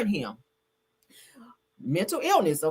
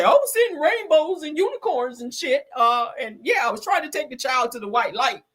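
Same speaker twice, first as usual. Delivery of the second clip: higher, airy mock voice of the dazed woman; 7 semitones higher than usual. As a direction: mimic her spaced-out excuse, higher. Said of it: "walk up and then say".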